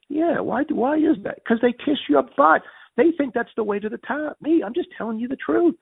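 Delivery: high-pitched, animated retelling of an argument; animated retort. Retold or imitated. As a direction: argue back insistently, pitched well up. Acting out heated meeting argument.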